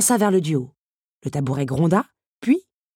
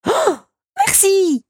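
very high delighted little squeal; gasp, then burst out high with thanks; enthusiastic child; 12 semitones higher, 4 dB louder, slower than usual. A narrator's reading. Little teacup thanking the beast, enthusiastic. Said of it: "s'enthousiasma la petite tasse".

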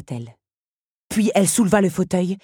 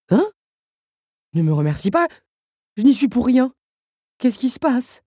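bewildered; 7 semitones higher, 5 dB louder than usual. A narrator's reading. Puppet Henri reacts, bewildered.

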